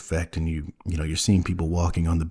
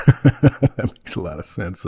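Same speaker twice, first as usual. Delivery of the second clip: slowed, laughing through the words; laughing at how obvious it is; slower than usual. Real, not acted. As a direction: chuckle it out.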